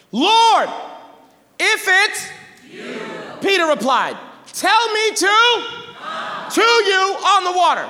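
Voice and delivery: high, loud pulpit reading; dramatic scripture reading. Acted. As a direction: ring out the verse, leaving room for responses. Reads scripture dramatically to congregation.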